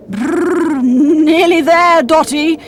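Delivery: slow, shivering call through the cold. A narrator's reading. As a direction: shiver as you speak. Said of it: "shivered Uncle Motley".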